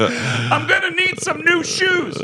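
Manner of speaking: imitated fighter brushing off the cop's question; high squeaky fighter. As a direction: squeak it out, breezily unbothered. Fighter answers cop.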